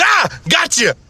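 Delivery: high, gleeful shout; spring the trap with a crow of triumph; triumphant gotcha. Acted. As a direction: pounce on it, high-pitched. Husband springs his trap on wife.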